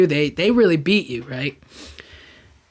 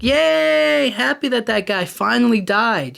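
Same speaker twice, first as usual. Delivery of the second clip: higher, gleeful mock celebration; mock cheer; 5 semitones higher, slower than usual. People glad the man died, mock cheering. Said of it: "these people who are like".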